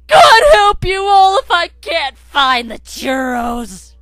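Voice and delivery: slow, very loud high yell; dramatic shout. Acted. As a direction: bellow it like a doomsday warning. Over-the-top shouted finale.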